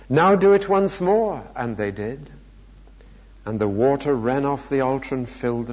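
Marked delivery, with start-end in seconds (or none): 0.1-1.4 high, louder, emphatic order; insistent command. A narrator's reading. Elijah orders the final soaking, insistent.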